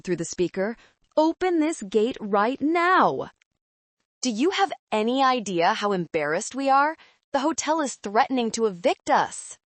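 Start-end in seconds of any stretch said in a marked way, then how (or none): 1.2-9.6 angry demand. A narrator's reading. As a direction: demand it furiously. Angry father through intercom.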